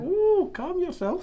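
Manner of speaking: high teasing ooh; mock soothing. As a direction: tease him to calm down. Teasing co-host.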